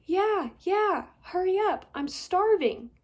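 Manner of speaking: high, loud and bored; impatient girl yelling. Acted. Trapped girl calling to the chief, high impatient yell. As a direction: yell back, fed up and hungry.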